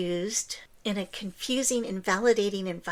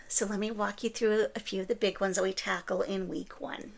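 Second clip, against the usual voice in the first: ordinary talk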